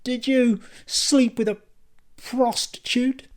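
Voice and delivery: high, slow, leaning hard on prostitute with disgust; disgusted accusation. A narrator's reading. Kylie confronting Dan in disgust, high and slow. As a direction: spit out prostitute like something filthy. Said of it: "accentuated the last word with the sort of disgust".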